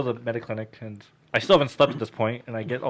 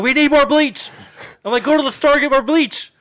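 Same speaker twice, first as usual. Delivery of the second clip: much higher and louder panicked shout; frantic yell; 13 semitones higher, 9 dB louder than usual. Retold or imitated. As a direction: yell the order at dad. Yelling orders at dad.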